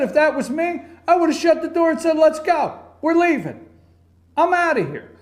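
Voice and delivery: high-pitched, emphatic quoted bravado; imitating a defiant walk-out; Trump. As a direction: push it up high and declare it. Acted quote of Trump walking out.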